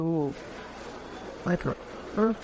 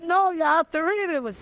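higher, acted-out insistent correction; emphatic insistence; 7 semitones higher than usual. Young volunteer correcting the man a second time, emphatically insistent. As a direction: press the retold point harder, pitched right up. Acts out repeating the instruction, more insistent.

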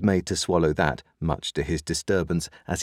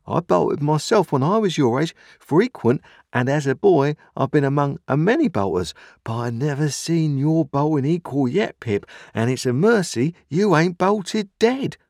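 high, louder, earnest character voice; amazed Joe character; 10 semitones higher, 4 dB louder than usual. A narrator's reading. Joe telling Pip of his own boyhood bolting, amazed. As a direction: marvel in a pitched-up, emphatic voice.

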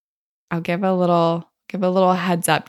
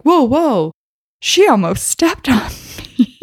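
higher, louder startled whoa; 7 semitones higher, 5 dB louder than usual. Retold or imitated. Guest pulling his foot away, higher and louder, startled. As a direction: jerk back in alarm.

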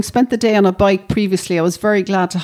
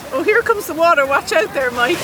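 excited squeal; 7 semitones higher than usual. Real, not acted. Wave washing over her feet.

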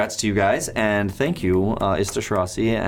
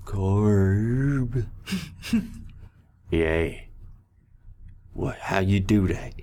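goblin voice; 3 dB quieter, much slower than usual. Acted.

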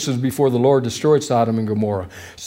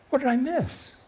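higher, hushed mock disbelief; mildly stunned, as if you missed something; bewildered; 9 semitones higher, 8 dB quieter than usual. Preacher voicing his own bafflement at the mother's request, higher and softer.

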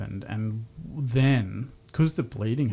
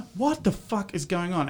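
retold panic; 8 semitones higher than usual. Panicking while driving, wife bleeding.

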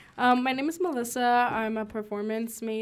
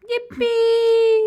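excited cheer; 12 semitones higher, 12 dB louder than usual. Real, not acted. Cheering hometown mention.

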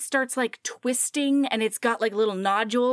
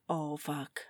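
low, hushed dread; quiet acted oh-no moment; dismayed realisation; 8 semitones lower, 8 dB quieter than usual. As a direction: sink low and soft, talking to yourself. Her realisation after the slip.

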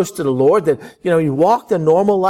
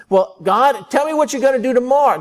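mock demand; 9 semitones higher than usual.

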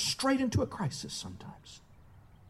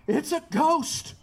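higher, drawn-out cry of alarm; mock cry of fear; 4 semitones higher, slower than usual. Preacher voicing the frightened disciples, mock cry of fear. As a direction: cry it out slowly, pitch lifted. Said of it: "they cried out".

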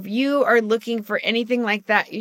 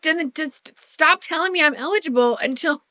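higher, fed-up pleading voice; exasperated retort; 7 semitones higher than usual. Retold or imitated. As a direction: push back, exasperated. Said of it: "I'm like".

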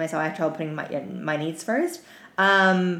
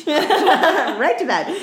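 slow, laughing and louder; 10 semitones higher, 8 dB louder, much slower than usual. Real, not acted. Host joking she is going straight to bed, slow laughing. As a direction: giggle it out, drawn out.

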